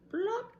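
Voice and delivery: high, short plop; breaking chair noise. Acted. Storyteller imitating the chair giving way, comic plop. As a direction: pop it out high and loud.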